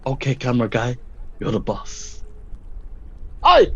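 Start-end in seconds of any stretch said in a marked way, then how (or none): 3.4-3.7 shout. Acted. Calling out to the tribe.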